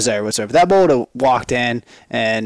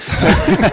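high bright burst of laughter; laughing burst; 8 semitones higher, 3 dB louder than usual. Real, not acted. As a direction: laugh it off loudly. Laughing at own frustration.

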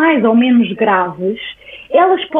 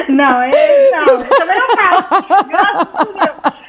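laughing; 5 semitones higher, slower than usual. Real, not acted. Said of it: "nunca mais consegui fazer cocó nas calças".

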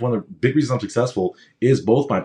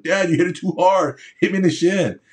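a hint of a complaining kid, higher; lightly voiced boy griping to his father; whiny kid; 7 semitones higher than usual. Boy complains to dad the ball hit his shin, mildly whiny. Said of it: "I'm like".

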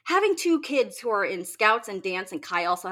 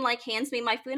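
ordinary talk

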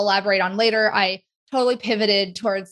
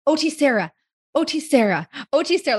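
higher, repeated kid's tic, imitated; imitated verbal tic; 5 semitones higher than usual. Retelling a kid who loved OT Sarah, imitated tic. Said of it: "one of his tics was".

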